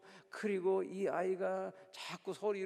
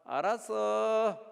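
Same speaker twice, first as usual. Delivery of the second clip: put-on child's reply; 2 semitones higher, 7 dB louder, much slower than usual. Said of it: "이 꼬마가 엄마 귀에 대고 이렇게 말합니다".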